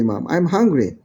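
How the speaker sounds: usual speaking voice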